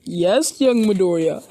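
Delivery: booming hero reply; All Might. Acted. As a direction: answer loudly as the hero. All Might answers loudly.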